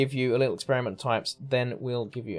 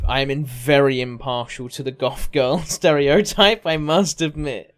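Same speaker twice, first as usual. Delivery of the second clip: laughing; 8 dB louder, slower than usual. Real, not acted. Laughing confession on stream.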